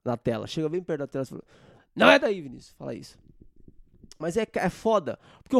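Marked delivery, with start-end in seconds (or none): 1.9-2.7 loud, higher shout of correction; shout at the screen. Shown as a demonstration. Showing viewers how to shout the correction at him. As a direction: yell it right into the screen. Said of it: "Dá um Google e grita pra mim".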